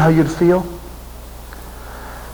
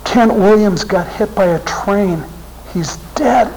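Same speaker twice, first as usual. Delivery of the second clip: higher, breaking with shock; stunned disbelief; 5 semitones higher, slower than usual. Real, not acted. Repeating the news of the missionary's death in stunned disbelief. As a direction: say it as if you can't believe it. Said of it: "I couldn't even believe it".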